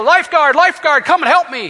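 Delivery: high, loud mock yell for the lifeguard; calling for help. Drowning swimmer calling the lifeguard, mock yell. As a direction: call out like a swimmer going under. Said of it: "and you yell".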